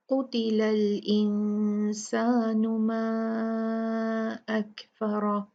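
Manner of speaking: slow recitation, heavy qaf in कुतिलल. Shown as a demonstration. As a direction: Quranic recitation. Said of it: "काफ heavy होगा".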